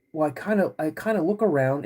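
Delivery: usual speaking voice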